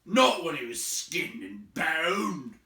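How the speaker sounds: loud, slow troll reply; grim troll. Acted. Troll musing about skinning him, relishing. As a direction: relish the thought.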